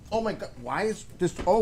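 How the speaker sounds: normal talking voice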